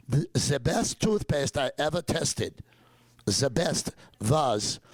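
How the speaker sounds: slightly hammed-up German-accented quote. German doctor praising a toothpaste he tested, in a German accent. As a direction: imitate a German doctor, z for "the", v for "was". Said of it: "me doing the German accent".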